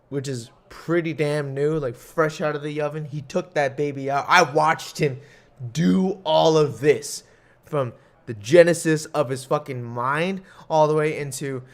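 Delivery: ordinary talk, nothing marked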